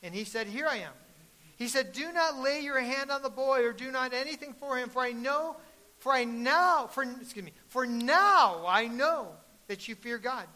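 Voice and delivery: loud, high, fervent preaching; preaching at full force. Acted. Preacher proclaims the angel's command to the congregation, shouting fervently. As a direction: proclaim it to the whole church.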